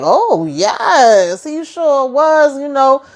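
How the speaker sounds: high, giddy, teasing woman's voice; high tipsy Nancy. Retold or imitated. Recapping the show, voicing Nancy confessing to Richard, in a high giddy voice. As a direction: play her as a giddy, oversharing flirt.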